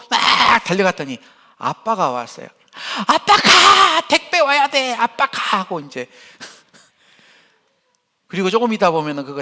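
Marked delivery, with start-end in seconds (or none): 3.1-5.6 put-on high, loud, slow child's yell; a little kid shooing dad away; child shouting. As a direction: grandson yelling at his dad at the door, high and loud.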